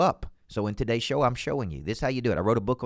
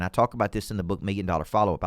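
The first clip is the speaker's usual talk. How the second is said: normal talking voice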